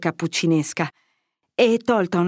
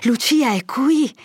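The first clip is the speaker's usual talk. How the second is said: higher, louder, slow disbelief; amazed, drawn-out cry of hope; astonished question; 6 semitones higher, 4 dB louder than usual. A narrator's reading. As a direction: let the surprise lift the whole question. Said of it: "sclamò il padre".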